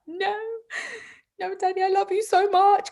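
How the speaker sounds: very high-pitched imitation of a pleading daughter; mock child's wail. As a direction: wail it like a distraught little girl. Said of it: "she starts to go like that".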